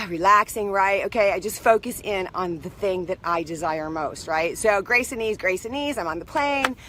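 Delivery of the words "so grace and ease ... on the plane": normal talking voice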